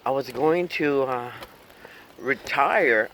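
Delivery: ordinary talk, nothing marked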